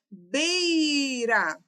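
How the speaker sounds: "beira" with BEI stretched long, high and loud; stressed syllable drawn out. Shown as a demonstration. Teacher demonstrates the stressed diphthong, "beira" with BEI loud and stretched. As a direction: call out "beira" as BEEEI-ra, leaning on the first syllable. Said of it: "a sílaba tônica é o bei".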